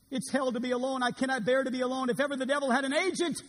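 anguished pleading. Acted. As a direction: plead not to be left alone. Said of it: "And he pleaded".

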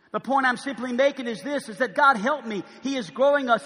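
normal talking voice

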